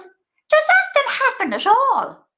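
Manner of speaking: high, slow, put-on voice of an old Irish mother; high slow mammy voice. Mimicking her mother on the phone, high and slow. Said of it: "And she goes".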